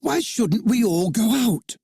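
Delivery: high, excited suggestion; eager boy. A narrator's reading. John urges the others to fly outside, eager. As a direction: pitch it as a thrilling idea. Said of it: "cried John".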